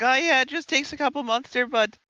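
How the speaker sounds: high, hammed-up Canadian accent. Streamer mimics people on covid cough, mock Canadian accent. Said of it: "really into this Canadian accent".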